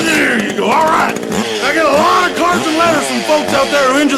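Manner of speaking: high-pitched, loud voice through pain; high strained pain. Acted. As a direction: push through agony, voice cracking upward. Injured badly, voice climbs.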